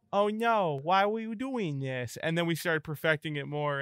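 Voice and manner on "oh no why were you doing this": put-on higher toddler whine. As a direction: whine it like a small child. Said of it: "We all started going".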